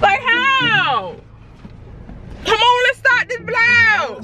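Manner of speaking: high, drawn-out ratchet whine; put-on ratchet. Prankster pushing fiance to film, high slow ratchet. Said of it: "Why are you talking like that?".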